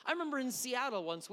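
normal talking voice

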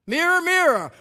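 high, loud, commanding queen; evil queen voice. Retold or imitated. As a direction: call out to the magic mirror. Fairy tale queen quoted.

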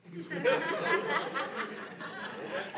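a high, airy burst of laughter; high breathless laugh. Real, not acted. Pastor cracking up at the ex-prisoner's question, breathless high laugh.

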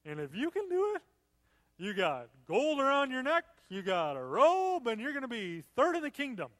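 nervous tipsy king making promises; scared drunk king. Shown as a demonstration. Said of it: "it might sound a little more like that".

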